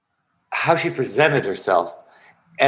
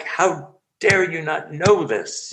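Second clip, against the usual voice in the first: high, slow fierce reproach; fierce teacher; 6 semitones higher, slower than usual. Retold or imitated. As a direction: demand it like a stern teacher. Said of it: "coming at you with a hatchet".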